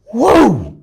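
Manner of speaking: high, loud amazed whoa; excited whoa. Acted. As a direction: burst out in amazement at the find. Amazed at huge meth shard.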